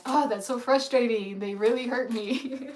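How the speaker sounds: high, loud, fed-up mock complaint; exaggerated self-pitying gripe; mock whiny complaint. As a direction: act out being put out and annoyed. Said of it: "that's me not being understanding".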